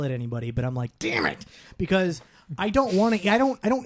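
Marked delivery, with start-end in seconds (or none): 1.0-1.4 low, contained mock curse; inward anger. Hungry man retelling his silent frustration, inwardly mad. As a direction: curse to yourself without yelling. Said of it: "inwardly, like, I don't yell".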